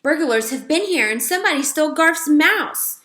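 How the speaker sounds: high, loud and breathless with news; alarmed. A narrator's reading. Girl telling aunt about the burglars, alarmed. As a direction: blurt out the alarm.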